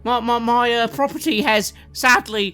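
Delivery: high, loud, flustered voice; flustered showman. Acted. Top-hatted showman fretting about the escaped goblin, flustered and loud. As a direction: babble in a panic, voice jumping up.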